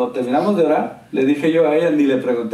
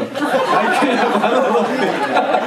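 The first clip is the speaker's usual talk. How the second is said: laughing joke; 8 semitones higher, slower than usual. Retold or imitated. Joking about dying woman.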